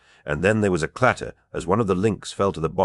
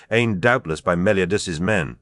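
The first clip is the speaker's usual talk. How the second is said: ordinary delivery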